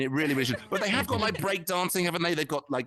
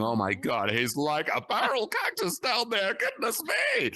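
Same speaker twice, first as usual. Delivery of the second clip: slow, much higher lady's voice shouting in shock; high mock lady's shriek; 4 semitones higher, slower than usual. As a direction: shriek it like a scandalised lady. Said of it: "and the ladies saying".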